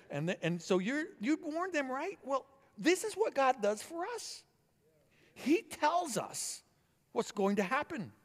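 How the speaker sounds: fervent sermon delivery; preaching at full force. Acted. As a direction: preach it out with rising fervour.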